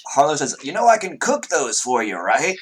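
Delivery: the cook offering to help; lightly voiced cook.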